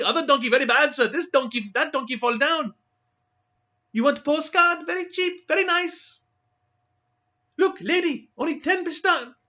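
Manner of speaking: high, loud pleading hawker voices; street vendors hawking. Acted. Bead and donkey sellers crowding tourists, high insistent hawking. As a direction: pester the tourists with cheap wares, pitched high.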